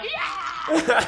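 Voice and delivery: high, loud laughing whoop; cheering yell. Retold or imitated. Queer students cheering the new kissing rule, laughing whoop. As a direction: whoop it up like the crowd.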